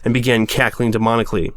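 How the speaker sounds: ordinary delivery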